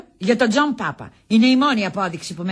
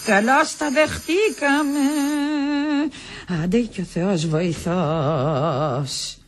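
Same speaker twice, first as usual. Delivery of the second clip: bracing herself; much slower than usual. Acted. Bracing herself for the coming storm.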